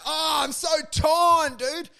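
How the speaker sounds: high theatrical despair; mock anguish. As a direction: wail it in mock agony. Voicing torn human.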